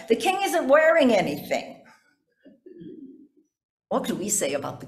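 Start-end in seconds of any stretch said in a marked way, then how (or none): 0.1-1.8 put-on a child's raised, higher call over a crowd; mild mock yell of a small child. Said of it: "yells out over the crowd".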